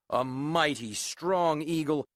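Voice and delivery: usual speaking voice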